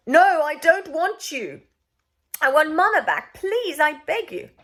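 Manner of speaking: put-on high, loud, slow begging boy; pleading child. A narrator's reading. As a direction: beg to get mother back.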